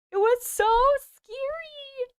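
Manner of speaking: very high, drawn-out squeal; playful fright. Pretend. Streamer agreeing with viewer about video, playful frightened squeal.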